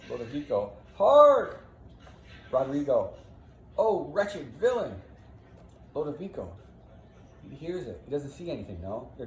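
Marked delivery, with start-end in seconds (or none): 0.9-1.6 sudden high, very loud shout; alarmed call. Acted. Character alerts companion to cries. 3.8-4.9 high, loud lament; wounded man crying out; yelling in distress. Acted. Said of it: "Roderigo off in the distance yelling".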